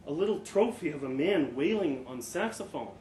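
plain reading voice

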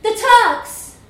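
high, loud alarm cry; called out. A narrator's reading. Hanging boy warns the others of the enemy, called out. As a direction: shout a warning of the enemy. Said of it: "called out".

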